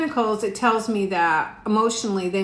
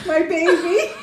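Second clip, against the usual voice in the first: laughing; 9 semitones higher, 6 dB louder than usual. Real, not acted. Fond laugh at daughter.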